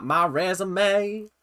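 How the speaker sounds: slow, drawn-out Southern drawl; Southern accent. Acted. Quoting the new mom's boast, mock Southern accent. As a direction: put on a sassy Southern queen accent. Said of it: "doing the Southern accent".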